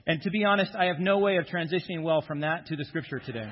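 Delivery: usual speaking voice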